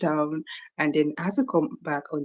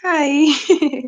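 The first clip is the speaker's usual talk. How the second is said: excited hi; 10 semitones higher, 6 dB louder than usual. Real, not acted. Greeting viewer on stream.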